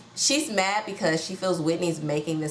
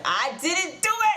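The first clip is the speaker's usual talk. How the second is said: high-pitched, hammed-up protest; mock yelling; 10 semitones higher than usual. Lisa denying it to Whitney, mock yelling. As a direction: imitate a yelled denial, voice shooting up high. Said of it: "Lisa starts yelling down Whitney's throat".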